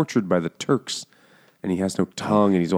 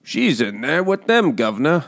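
mock English accent; 8 semitones higher than usual. Dim English soldier reporting to his superior, mock English accent.

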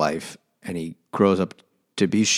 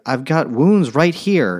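higher, louder, slow mock whine; mock wounded boy; 7 semitones higher, 5 dB louder, slower than usual. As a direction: ham up the injured kid. Mocking Heathcliff showing wounds.

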